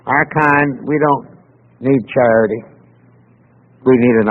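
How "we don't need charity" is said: usual speaking voice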